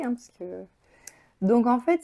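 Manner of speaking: ordinary delivery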